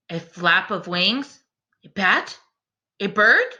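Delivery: slow, lilting bedtime rhyme reading; story narration. A narrator's reading. Reading a rhyming bedtime book to the class, gentle storyteller voice. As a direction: read it like a picture book to little ones.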